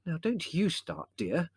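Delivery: high, slow motherly warning; mild, firm reproof. A narrator's reading. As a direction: check them firmly but without shouting. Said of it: "said Mother firmly".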